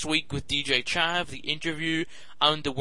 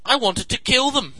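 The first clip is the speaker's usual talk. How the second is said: mock rage; 9 semitones higher, 5 dB louder than usual. Real, not acted. Exaggerated punchline.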